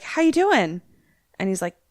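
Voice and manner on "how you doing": forced casual greeting. Retold or imitated. Dad fakes casual on phone.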